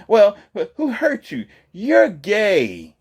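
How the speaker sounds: very high mocking woman's voice. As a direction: scoff it in a high put-on voice. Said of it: "And she say".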